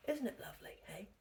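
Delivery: hushed, fond whisper; a soft whispered aside. Real, not acted. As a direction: whisper it lovingly. Whispered aside about yarn.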